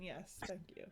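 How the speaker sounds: usual speaking voice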